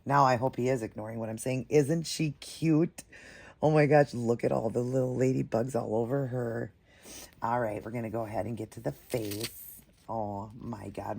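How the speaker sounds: usual speaking voice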